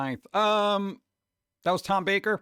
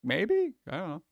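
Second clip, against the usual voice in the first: unsure maybe. Retold or imitated. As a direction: shrug it off, uncertain. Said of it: "I was just like".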